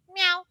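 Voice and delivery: a high little imitated meow; cat meow. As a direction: give a light cat meow. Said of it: "the cat's like".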